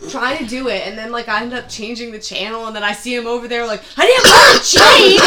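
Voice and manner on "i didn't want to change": much higher, louder whiny complaint; squeaky mocking customer voice; mock old guy. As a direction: whine it like a fussy old customer. Customer voiced, high pitched.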